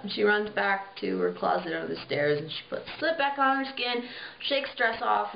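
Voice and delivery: ordinary delivery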